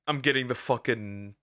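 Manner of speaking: normal talking voice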